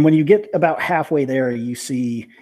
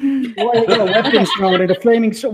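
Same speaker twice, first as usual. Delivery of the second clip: put-on high, sleepy confused kid; a kid just woken up, bewildered; groggy child voice; 9 semitones higher, 4 dB louder than usual. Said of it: "you hear a groggy voice, like, waking up".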